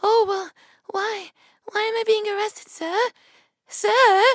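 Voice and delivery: high, slow, helpless pleading voice; damsel in distress. Retold or imitated. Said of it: "Now she acting like a damsel in distress".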